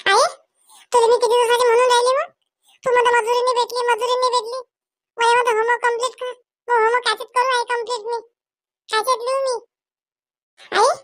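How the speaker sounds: put-on high little girl's complaining voice; whining child. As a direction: sulk at mother like a put-upon young girl. Child Pinky whines at mother. (लहान मुलगी आईकडे तक्रार करते, रडवेल्या उंच आवाजात)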